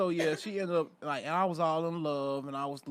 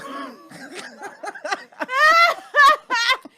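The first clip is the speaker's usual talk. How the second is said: high loud laughter; cracking up; howling laugh; big laugh; 11 dB louder than usual. Real, not acted. Laughing at his own story.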